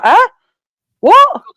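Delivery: sudden high confused yelp of surprise; a high startled double-take; baffled huh what. Retold or imitated. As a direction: act out being completely thrown. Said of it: "I'm like".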